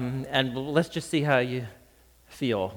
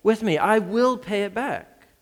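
higher, pleading plea for patience; a debtor pleading for mercy, voice raised high; begging; 8 semitones higher than usual. A narrator's reading. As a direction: beg on your knees. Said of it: "begged him".

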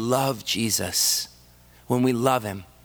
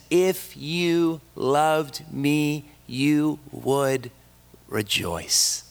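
slow solemn quote; 2 semitones higher, much slower than usual. Acted. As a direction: draw out each word slowly. Quoting Jesus, drawn out.

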